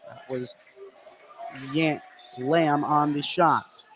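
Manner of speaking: normal talking voice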